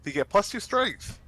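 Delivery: mock enthusiasm. Shown as a demonstration. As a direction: sell "plus two strength" like a prize. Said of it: "Cool, right?".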